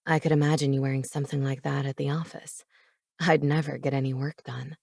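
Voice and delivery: low, quick male character. A narrator's reading. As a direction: drop low and flirt. Cade voiced low in narration.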